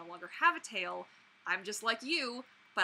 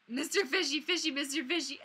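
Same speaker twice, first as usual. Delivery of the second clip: cooing at a toy; 5 semitones higher, 3 dB louder than usual. Pretend.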